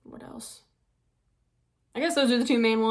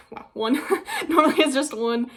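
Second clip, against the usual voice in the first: laughing through the words, higher and louder; 4 semitones higher, 5 dB louder than usual. Real, not acted. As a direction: laugh while saying it. Repeats it laughing.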